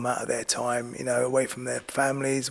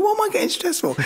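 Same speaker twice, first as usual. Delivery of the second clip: much higher, squeaky inner voice; mock panicked thought. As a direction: pitch it way up as your own frantic thoughts. Inner voice while nervous.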